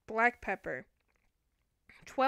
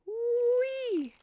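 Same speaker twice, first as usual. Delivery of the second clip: a much higher, stretched whee of glee; high playful whee; 12 semitones higher, 4 dB louder than usual. Real, not acted. Playful cheer.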